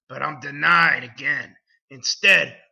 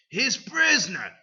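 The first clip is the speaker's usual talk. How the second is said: ordinary delivery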